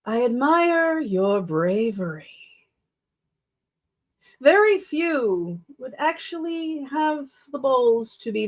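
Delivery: slow, drawn-out character voice; measured delivery with long, deliberate pauses; Christopher. Shown as a demonstration. Narrator testing an audiobook character's voice, slow put-on voice. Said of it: "see if I can remember how I did it".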